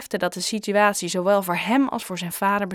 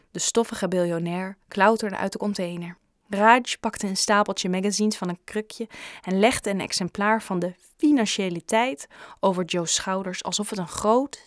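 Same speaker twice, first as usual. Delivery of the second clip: ordinary delivery, nothing marked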